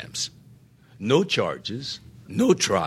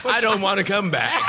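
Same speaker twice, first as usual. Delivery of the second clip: laughing; 11 semitones higher, 3 dB louder than usual. Real, not acted. Jokey reply through laughter.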